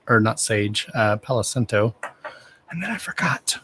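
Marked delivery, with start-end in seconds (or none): 2.7-3.6 breathy sheepish whisper. Real, not acted. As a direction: whisper the slip to yourself. Sheepish aside.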